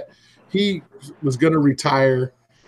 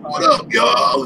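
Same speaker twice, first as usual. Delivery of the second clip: high, drawn-out shouted greeting; retold hollered greeting; 10 semitones higher, much slower than usual. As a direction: call out a long hello. Acts out Jay greeting people loudly.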